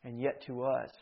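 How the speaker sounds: measured, hushed gravity with long pauses; solemn preaching. Real, not acted. Preacher weighs death against life, solemn preaching.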